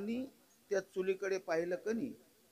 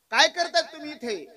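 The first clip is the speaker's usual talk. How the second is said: higher and louder question; sage calling out; 9 semitones higher, 9 dB louder than usual. Retold or imitated. Sage stepping closer and asking the woman loudly. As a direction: call across the riverbank.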